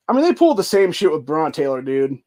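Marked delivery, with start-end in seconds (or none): none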